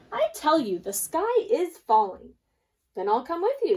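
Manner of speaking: urgent back-and-forth; insistent characters. Acted. Hen insists, duck agrees.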